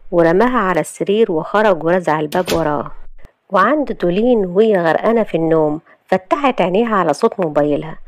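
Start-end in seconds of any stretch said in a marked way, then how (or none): none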